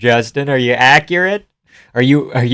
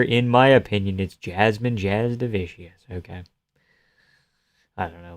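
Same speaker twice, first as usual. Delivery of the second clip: ordinary talk, nothing marked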